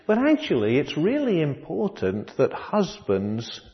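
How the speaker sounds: ordinary talk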